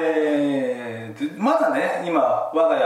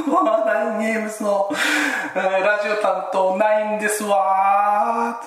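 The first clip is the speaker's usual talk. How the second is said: haughty heiress self-introduction; ojousama voice; 6 semitones higher than usual. Pretend. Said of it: "お嬢様言葉だと".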